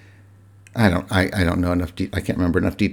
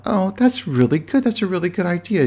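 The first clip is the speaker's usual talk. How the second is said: delighted; 9 semitones higher than usual. Retold or imitated. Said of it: "And at first I thought".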